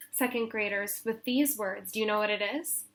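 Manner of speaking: ordinary delivery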